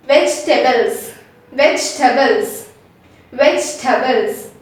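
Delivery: Vegetables said as vej-tuh-bles, three syllables; vegetables without the e. Shown as a demonstration. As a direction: say Vegetables as vej-tables, dropping the middle e. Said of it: "we have to say it as vegetables".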